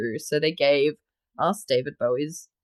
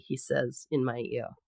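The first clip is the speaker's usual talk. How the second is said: ordinary talk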